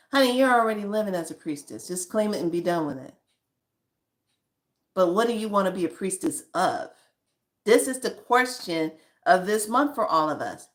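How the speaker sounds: ordinary delivery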